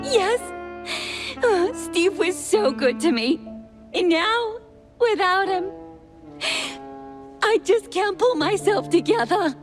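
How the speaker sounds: high-pitched, weepy lament; tearful. Acted. Distraught assistant tells detective about losing Steve, tearful. Broken woman mourning missing Steve.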